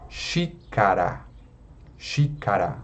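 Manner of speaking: xícara with a stretched, stressed "xí"; antepenultimate stress. Shown as a demonstration. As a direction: say xícara low, weight on the first syllable. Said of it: "cai na antepenúltima sílaba". (xícara com a primeira sílaba forte)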